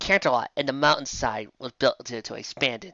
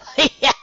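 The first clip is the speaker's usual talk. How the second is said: sharp high laugh; barked laugh; 12 semitones higher, 6 dB louder than usual. Acted. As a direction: bark out a laugh. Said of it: "barked out a laugh".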